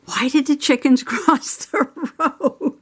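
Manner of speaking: high, laughing through the question; can't keep a straight face asking it. Retold or imitated. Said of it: "Mister Possum said to me".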